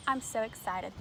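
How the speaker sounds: plain reading voice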